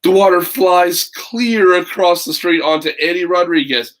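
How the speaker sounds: put-on lively read-aloud for children; story narration. A narrator's reading. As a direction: tell it like a picture book to kids. Reads picture book aloud.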